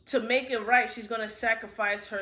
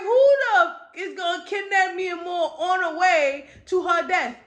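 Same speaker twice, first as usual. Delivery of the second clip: high, loud disbelieving exclamation; incredulous outburst; 6 semitones higher, 7 dB louder than usual. Retold or imitated. Storyteller reacting to the character being kidnapped on the way, loud disbelief. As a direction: burst out in disbelief, voice raised.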